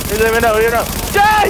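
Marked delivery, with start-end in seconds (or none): 1.1-1.5 fierce yell, much higher; battle yell. Real, not acted. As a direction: scream it at the enemy. Shooting enemies in game.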